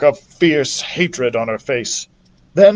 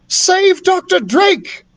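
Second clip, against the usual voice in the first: commanding; slower than usual. Acted. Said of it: "commanded Beatrice".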